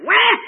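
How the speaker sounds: put-on high, loud baby cry; imitated baby wail. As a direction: wail like a newborn.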